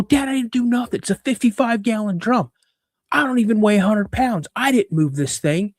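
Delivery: put-on high, pleading boy insisting he is innocent; a kid's high, aggrieved denial; boyish protest. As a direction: push back at Dad like a frustrated kid. Arguing with his father as boy.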